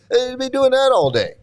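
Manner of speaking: high, laughing and crying howl; mock ecstatic laughter. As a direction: ham up a blissful laughing fit. Mocking blissful laughing devotees.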